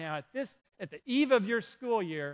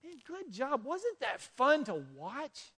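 higher, delighted praise from God; God congratulating a spent believer, higher and delighted; God's praise; 6 semitones higher, slower than usual. Pretend. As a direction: cheer them on like a proud God. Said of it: "don't you want to hear God say".

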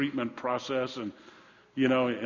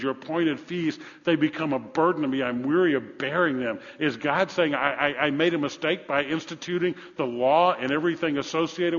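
raised, driving sermon delivery; forceful preaching; 4 semitones higher, 5 dB louder than usual. Acted. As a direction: preach God's rebuke with insistent force. Preaches at full force from scripture.

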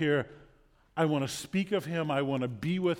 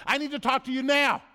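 much higher, loud imitated child's demand; demanding kid; 10 semitones higher, 5 dB louder than usual. Preacher imitating kid confronting Santa, demanding yell. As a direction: yell it like a kid who won't wait. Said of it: "looks in his face and says".

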